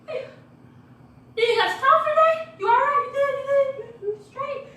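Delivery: high, loud mock concern; worried roommates. Roommates checking why she has no coffee, high and loud. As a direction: squeal it like friends fussing over her. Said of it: "they were like".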